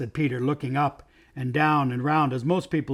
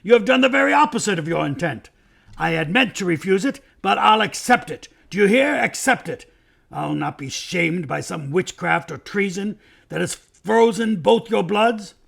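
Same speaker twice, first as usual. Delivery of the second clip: loud, higher, indignant king; angry outburst; 6 semitones higher, 6 dB louder than usual. A narrator's reading. King refusing to be shamed before his lords, angry outburst. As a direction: rage at them with raised voice.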